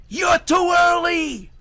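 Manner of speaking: high, slow panicked shriek. Acted. Herschel shrieking at the goblin wind. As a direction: shriek it out in terror. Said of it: "Herschel shrieked".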